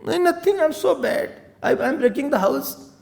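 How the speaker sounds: high, whiny sulk; sulky servant. Acted. Manthara sulks at Kekai, high whine. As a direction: sulk and refuse.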